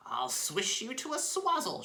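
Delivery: very high furious hag's threat; raging shout. Acted. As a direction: spit the threat in fury. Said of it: "she sh-, shouted".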